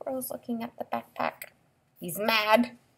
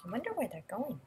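plain reading voice

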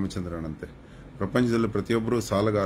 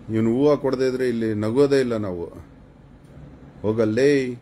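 loud, raised-voice scoff; scoffing dismissal; 3 semitones higher, 5 dB louder than usual. Real, not acted. Live streamer waving off a commenter, loud and scornful. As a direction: brush the viewer off loudly.

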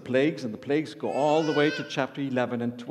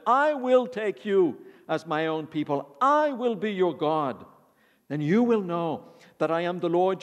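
higher, louder proclaiming read; preacher proclaiming God's promise to Israelites; proclaiming scripture; 4 semitones higher, 4 dB louder than usual. Acted. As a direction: declare the promise with full force.

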